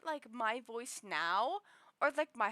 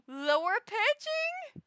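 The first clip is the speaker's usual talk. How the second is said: surprised; 9 semitones higher, 6 dB louder, slower than usual. Real, not acted. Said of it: "lure pitching is".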